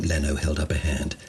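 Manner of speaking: usual speaking voice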